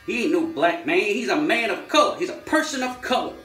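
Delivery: high, loud hammed-up American protest; mock indignant correction. Acts American correcting terminology.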